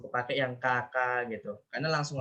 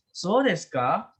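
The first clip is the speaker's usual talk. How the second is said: higher, slow "So desu ka" climbing on "ka"; rising question intonation; 4 semitones higher, 3 dB louder, much slower than usual. Shown as a demonstration. Teacher acting a never-ending exchange, "So desu ka" rising as a question. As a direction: ask "So desu ka" with the last syllable raised. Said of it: "Kalau naik itu dia jadinya nanya".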